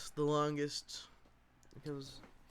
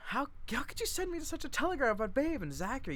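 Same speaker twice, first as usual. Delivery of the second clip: much higher, rushed worry; alarmed question; 13 semitones higher than usual. Acted. Meg asking her sister about the telegram, much higher. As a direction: press for answers in alarm.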